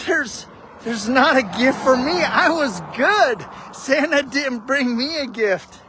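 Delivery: put-on high, tearful little boy protesting; a hurt kid telling his dad Santa forgot him; crying child's plea. Small boy sobbing to his dad that Santa skipped him, tearful. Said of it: "And I just started crying".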